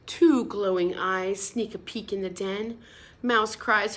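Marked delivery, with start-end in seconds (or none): none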